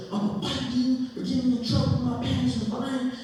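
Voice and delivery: high-pitched imitation of an aggrieved schoolboy; mock threatening kid. Imitates the boy threatening a fight.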